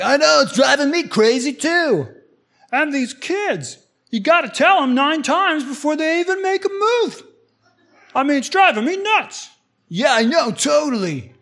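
high, exasperated put-on voice; hammed-up commiserating, at wit's end; upset griping. Husband agreeing and griping about the kids, exasperated. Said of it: "things that you were upset about".

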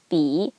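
"bi" dipping low and rising; third tone. Shown as a demonstration. Teacher pointing out tone mark, "bi" dipping then rising. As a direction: let "bi" fall and come back up. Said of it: "third tone".

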